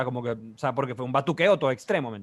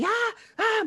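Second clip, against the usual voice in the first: imitated screams. Cousin in shock after the crash, imitated high screams. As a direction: imitate a panicked scream without full volume. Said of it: "empieza a pegar gritos así".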